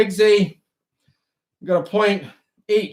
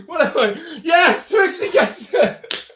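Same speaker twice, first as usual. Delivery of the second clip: giggling; 9 semitones higher than usual. Real, not acted. Host laughs while guessing game plays.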